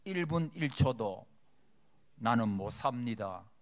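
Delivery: 따라 하도록 느리게 또박또박; 한 마디씩 끊어서 천천히 선창; slow for repeating. Shown as a demonstration. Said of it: "자, 따라합시다". (회중이 따라 하도록 천천히 선창하듯)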